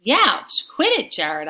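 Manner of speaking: high, loud yelp of protest; storybook yell of pain. A narrator's reading. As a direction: yell it as if just jabbed. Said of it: "I yell".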